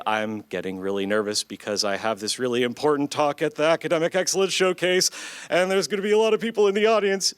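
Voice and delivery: nerves building, pitch climbing and pace quickening; nervous, building. Shown as a demonstration. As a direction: demonstrate mounting nervousness, voice rising and speeding up. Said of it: "The pitch of my voice is going up".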